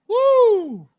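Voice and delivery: a high, loud cheering whoop; real celebratory woo; excited woo. Real, not acted. Cheering a figure announcement.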